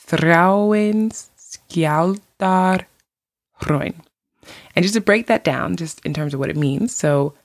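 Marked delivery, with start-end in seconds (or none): 0.1-3.9 Þráinsskjaldárhraun drawn out slowly for learners; slower demonstration. Shown as a demonstration. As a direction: say Þráinsskjaldárhraun slowly, each part set apart. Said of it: "I will say it slower".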